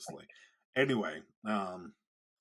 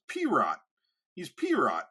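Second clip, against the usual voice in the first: high, loud, scornful "P-Rot"; godfather impression; 8 semitones higher, 6 dB louder, much slower than usual. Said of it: "picture that impression with a very heavy Boston accent".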